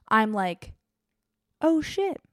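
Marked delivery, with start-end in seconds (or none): 1.6-2.2 higher, hushed oh no moment; sudden sinking realization; dismayed gasp. Retold or imitated. Traveler realizes the bags must be rechecked, dismayed.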